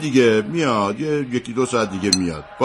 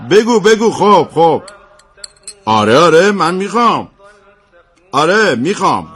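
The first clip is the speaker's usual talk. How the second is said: excited on phone; 7 semitones higher, 5 dB louder than usual. Acted. Excited phone call while eating.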